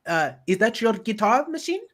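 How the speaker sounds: high, bubbly mock party chatter. Mimicking the film's cheery characters beside the repressed one, bubbly. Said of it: "all bubbly".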